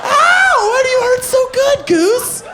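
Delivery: squeals of pain, very high; high squealing voice. Acted. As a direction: shriek it, hurt but loving it. Pained squeals, goose voice.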